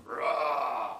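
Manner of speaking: mock growl to scare; playful monster roar. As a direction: bark it like a lurking beast. Shadow man threatens.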